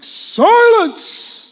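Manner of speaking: huge, drawn-out hammed-up command; booming movie-style Jesus. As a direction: bellow it like an epic hero commanding a storm. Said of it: "in a deep barreling voice".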